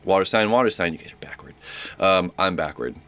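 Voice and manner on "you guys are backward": whispered aside. Real, not acted. Unvoiced aside.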